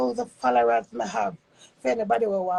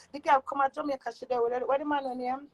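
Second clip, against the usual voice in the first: usual speaking voice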